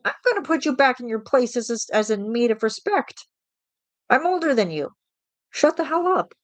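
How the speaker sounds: high, snippy put-down rising to a squeak; snark. Retold or imitated. Snapping back at disrespectful young coworkers, high snarky. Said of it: "I give them snark".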